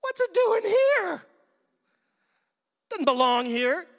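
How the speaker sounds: very high, loud, slow shout; shrieking alarm at the ark turning up. Acted. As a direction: yell it out in shock. Acted alarmed shout in skit.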